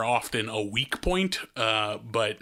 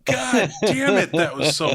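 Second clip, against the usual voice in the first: high, loud exclaim; high loud delighted curse; overjoyed; 5 semitones higher, 5 dB louder than usual. Real, not acted. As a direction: shout it in delight. Cracking up at the joke.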